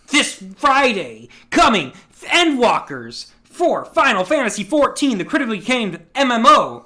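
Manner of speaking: big punchy ad read; mock announcer. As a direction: hype it like a game trailer. Mock game advert announcement.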